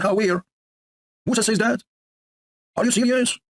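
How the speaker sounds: high-pitched incredulous questions; hammed-up "are you serious" incredulity; mock disbelief. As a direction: question it in high, staged astonishment. Acts out disbelief at the quote.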